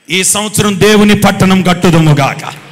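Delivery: very loud preacher's declaration; booming proclamation. Real, not acted. Preacher commits the congregation to building God's city, booming. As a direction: proclaim it over the whole congregation.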